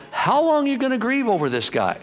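high-pitched impatient question, acted out; exasperated chiding. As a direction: voice God fed up with the moping. God chiding grieving Samuel.

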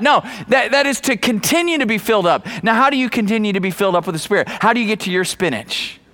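usual speaking voice

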